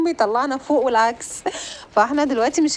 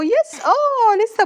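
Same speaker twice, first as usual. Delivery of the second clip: much higher and louder cheer; excited yes; 12 semitones higher, 5 dB louder, much slower than usual. Real, not acted. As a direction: yelp with joy at nearly winning. About to win the level. (صرخة فرح عالية)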